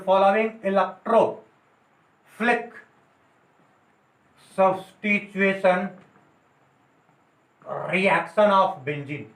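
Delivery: very slow, with long gaps between words; teacher gives the correct heading slowly for notes; slow dictation. Shown as a demonstration. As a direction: dictate "following electrophilic substitution reaction of benzene" word by word. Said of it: "लिख सकते हो".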